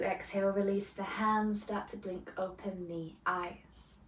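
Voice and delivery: soft, unhurried meditation voice; guided meditation. Acted. Yoga teacher guiding students into calm, soft meditation voice. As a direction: ease the class gently into stillness. Leads opening meditation before lesson.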